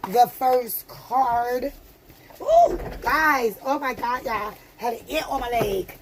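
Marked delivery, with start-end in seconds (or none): none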